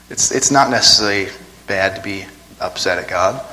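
normal talking voice

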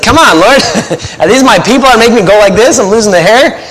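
half-joking groan of frustration at difficult people; exasperated plea to God. Pretend. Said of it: "It's like".

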